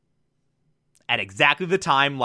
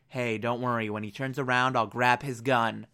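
hushed reassurance; 6 semitones lower, 7 dB quieter than usual. Retold or imitated. Light whispers plan to girl.

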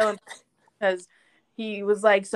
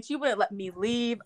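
usual speaking voice

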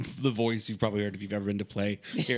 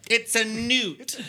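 high, drawn-out movie quote; put-on high-pitched correction; Matilda impression; 11 semitones higher, 4 dB louder, much slower than usual. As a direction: quote it high and slow, stretching the words. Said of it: "Matilda would tell you it's a newt".

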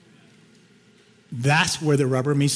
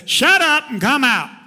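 sharp, higher, louder rebuke; annoyed command; 10 semitones higher, 4 dB louder than usual. Retold or imitated. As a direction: cast the spirit out with sudden irritation. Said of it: "Paul got a little annoyed with it".

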